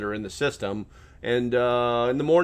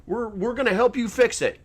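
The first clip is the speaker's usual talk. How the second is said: high, louder eager business voice; mock business owners; 11 semitones higher, 5 dB louder than usual. Mocking businesses promising software money, high and louder. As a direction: chirp it like a cheerful company apology. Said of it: "businesses are all gonna be like".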